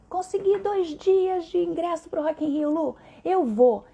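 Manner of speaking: high, slow, thrilled announcement; excited patient. Retold or imitated. Patient telling his voice therapist he got Rock in Rio tickets, overjoyed. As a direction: gush it like you just scored tickets.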